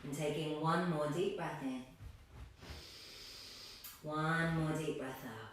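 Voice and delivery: calm, low-key breathing cues, letting phrases settle; guided meditation. Acted. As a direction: guide them gently into stillness, unhurried.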